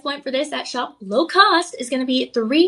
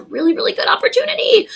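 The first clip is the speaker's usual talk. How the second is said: excited pitch; 10 semitones higher, 4 dB louder, faster than usual. Real, not acted.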